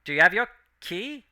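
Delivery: intercom voice. Acted.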